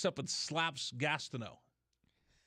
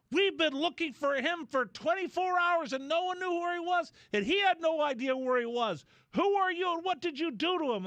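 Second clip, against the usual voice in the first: high, loud imitation of a furious woman; mock frantic woman; 12 semitones higher, 4 dB louder than usual. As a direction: voice her high and demanding. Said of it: "She goes".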